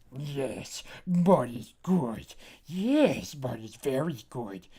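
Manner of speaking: slow, strange creature voice savouring each word; mud pillow talk. Shown as a demonstration. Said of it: "never talk like myself and I'm like".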